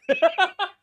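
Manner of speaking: high, loud burst of laughter; sudden cackling laugh. Real, not acted. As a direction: crack up at your own joke. Laughs at his own quote.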